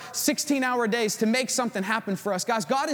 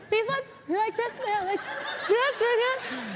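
high squeaky imitation of a woman showing off pants; mock girly voice; 13 semitones higher than usual. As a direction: raise the pitch way up and play the wife. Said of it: "like, the girls".

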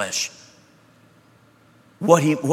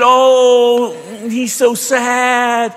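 high, very slow cooing pity voice; doting pet owner; 10 semitones higher, 6 dB louder, much slower than usual. Pretend. Owner about their pet, mock cooing pity. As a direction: coo over a sad dog. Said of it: "How many of you said".

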